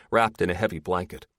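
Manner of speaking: plain reading voice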